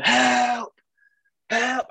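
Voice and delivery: high, loud drawn-out cry for help; shouting for rescue in a retelling; mock scream. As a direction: call out for a lifeguard, pitched high. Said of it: "I started screaming".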